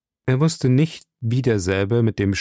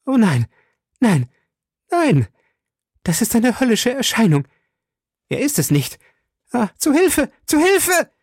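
very high, panicked cries; frantic screaming, far above usual pitch; terrified shriek; 13 semitones higher, 3 dB louder than usual. A narrator's reading. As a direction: shriek for help in mounting terror. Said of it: "kreischte sie mit heiserer Stimme".

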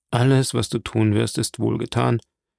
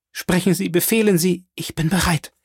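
higher-pitched, urgent readiness; urgent resolve; 7 semitones higher than usual. A narrator's reading. Valentine puts herself at Morrel's command, urgent resolve. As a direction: offer yourself with a rising urgency.

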